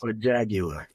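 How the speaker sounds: imitated British car-ad voice; mock British luxury-brand announcer; British accent. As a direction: say Jaguar like a British commercial. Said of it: "that was the voice accent that they would use".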